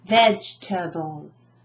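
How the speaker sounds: "Vegetables" slowly as VEJ-tuh-bulls; "Vegetables" in three beats, first one strongest; stress on first syllable. Shown as a demonstration. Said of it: "Where's the emphasis?".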